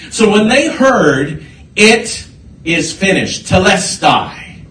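impassioned preaching, hitting each phrase hard; forceful preaching. Real, not acted. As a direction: build it with punched repetitions, like a preacher.

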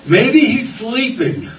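high, drawn-out mocking singsong; exaggerated acted cockiness, stretched out high; cocky taunt. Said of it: "Elijah got a little cocky with them".

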